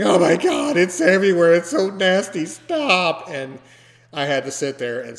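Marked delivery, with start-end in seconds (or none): 0.0-3.3 loud, high-pitched mock panic; imitated screaming. Imitating the associate screaming behind the bathroom door, loud panicked shriek. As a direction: scream it out in exaggerated disgust. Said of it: "he was screaming".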